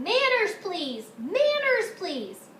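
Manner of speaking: high, loud repeated shout; papa shouting over the quarreling kids, high and loud. A narrator's reading. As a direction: bellow over the noisy family.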